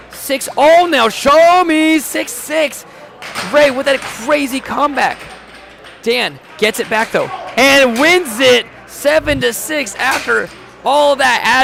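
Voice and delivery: loud, high, thrilled call; excited shout. Real, not acted. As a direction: yell it as the comeback lands. Comeback ties the game.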